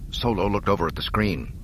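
usual speaking voice

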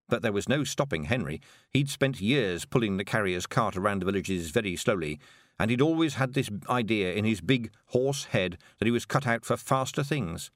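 plain reading voice

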